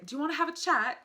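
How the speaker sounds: playful put-on British accent. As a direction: imitate a UK reality-show guy asking to chat. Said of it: "I'm not great at the accent".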